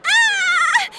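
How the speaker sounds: piercing high furious scream. Acted. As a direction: shriek in rage. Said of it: "Courtney screamed".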